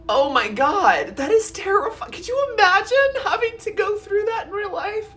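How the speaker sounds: high, tearful squeal of horror; real teary fright. Real, not acted. Storyteller reliving an orca nightmare, teary and frightened. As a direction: voice climbing high, close to crying. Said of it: "getting teary-eyed thinking about".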